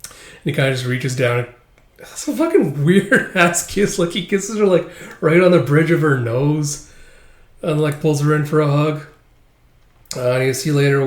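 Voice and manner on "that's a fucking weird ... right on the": laughing disbelief. Real, not acted. Reader laughs at odd kiss.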